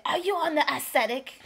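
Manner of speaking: loud, high challenge; incredulous retort. Retold or imitated. [name] questions the Americans' sanity, incredulous retort. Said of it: "And I say".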